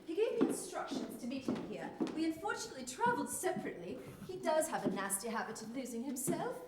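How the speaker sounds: put-on conversational English accent; Yorkshire woman's English accent. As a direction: chat politely in an English accent. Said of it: "You're speaking with an English accent".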